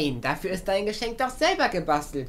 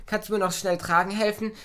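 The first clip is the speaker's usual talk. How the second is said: ordinary delivery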